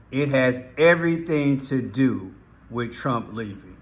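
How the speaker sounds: ordinary delivery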